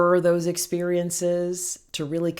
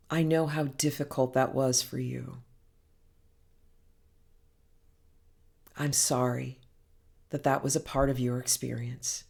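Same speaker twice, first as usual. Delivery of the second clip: tender self-compassion; 4 semitones lower, 6 dB quieter than usual. Shown as a demonstration. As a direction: console gently, letting each phrase settle. Demonstrates self-compassion, consoling oneself.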